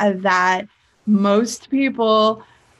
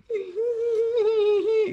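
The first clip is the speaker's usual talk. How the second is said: mock nervous laugh; 13 semitones higher, 5 dB quieter than usual. Mom's fake reaction laugh.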